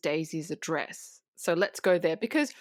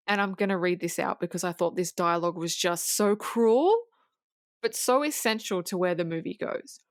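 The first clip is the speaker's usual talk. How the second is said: ordinary delivery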